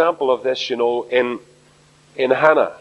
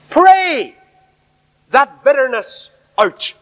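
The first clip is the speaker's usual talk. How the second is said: preached shout; 7 semitones higher, 4 dB louder, slower than usual. Real, not acted. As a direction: Pray flung out high and loud. Preacher's climactic exhortation.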